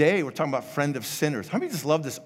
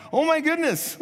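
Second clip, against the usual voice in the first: high-pitched mock astonishment; exaggerated high exclamation; astonished burglar; 14 semitones higher, 4 dB louder than usual. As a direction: act out a startled burglar's gasped discovery. Startled burglar finds a parrot.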